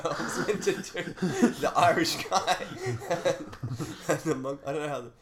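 talking through laughter; laughing. Real, not acted. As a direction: giggle through the words. Laughing at botched joke.

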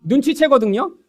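normal talking voice

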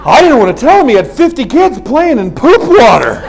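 loud laughing shout. Real, not acted. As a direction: crack up while telling it. Laughing telling poop punchline.

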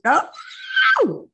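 sudden high startled "oh"; surprised yelp. Real, not acted. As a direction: caught off guard, squeak it out. Sudden squeal on seeing face.

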